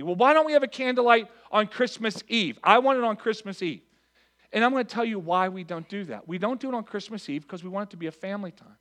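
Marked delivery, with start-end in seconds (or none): 0.0-3.8 higher, louder mock complaint; mock whiny churchgoer. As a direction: parody the grumbling member, pitched up. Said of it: "People even say to me".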